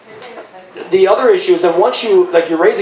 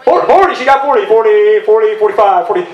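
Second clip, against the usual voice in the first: auctioneer; 4 semitones higher than usual. Pretend. Joking over an age limit, high-pitched auctioneer call. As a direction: call out bids in a rapid sing-out. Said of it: "you could be an auctioneer".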